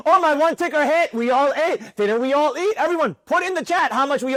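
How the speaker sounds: a hammed-up bragging signal-room guru; mocking hype trader. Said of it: "Anyone could post twenty tickers".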